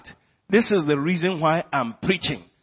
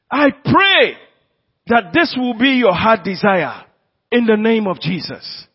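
raised, ringing prayer voice; impassioned pulpit prayer; fervent prayer; 4 semitones higher, 7 dB louder than usual. Acted. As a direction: call it out over the congregation. Prayer preached aloud.

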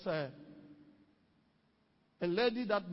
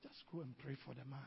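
soft pleading; 8 semitones lower, 13 dB quieter, faster than usual. Retold or imitated. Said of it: "my wife pleaded".

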